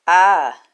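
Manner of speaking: sudden, loud, high yell right in his face; loud startled creature yell; dragon yell. A narrator's reading. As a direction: blast a startling yell at him. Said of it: "it yelled loudly in Leo's face".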